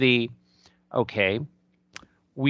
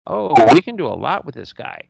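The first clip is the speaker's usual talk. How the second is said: excited; 7 dB louder than usual. Retold or imitated.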